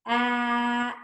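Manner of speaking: "At" held long, the T apart; checked slowly. Shown as a demonstration. Teacher checks hat with children, "At" sounded slowly. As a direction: stretch "At" out sound by sound. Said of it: "Let's check it slowly".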